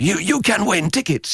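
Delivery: high-pitched promo hype; mock a prize announcement, pitched well up; radio promo voice. Producer imitating a ticket giveaway promo, hyped announcer voice.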